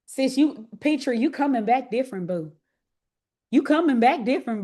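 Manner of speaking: usual speaking voice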